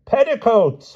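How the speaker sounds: high, loud, hearty interjection; booming. A narrator's reading. Princess completes the king's sentence, booming. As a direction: boom the word unabashed. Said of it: "boomed the Queen of Flanders' daughter".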